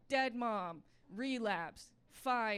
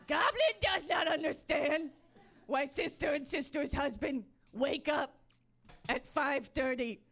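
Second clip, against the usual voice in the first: louder, higher goblin voice, fed up; ranting goblin; 4 semitones higher, 7 dB louder than usual. Acted. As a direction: let the goblin complain loudly in broken sentences. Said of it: "the goblin that's running on a treadmill in my head".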